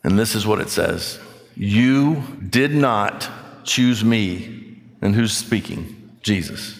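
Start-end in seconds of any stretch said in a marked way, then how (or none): 1.6-4.6 very slow, word by word; slow, weighty scripture quote; deliberate emphasis. Real, not acted. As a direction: spell out each word of the verse. Quotes key verse word by word.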